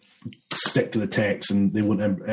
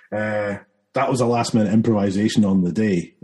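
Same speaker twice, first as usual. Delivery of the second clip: normal talking voice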